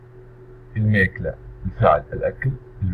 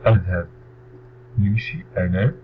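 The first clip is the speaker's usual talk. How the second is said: ordinary talk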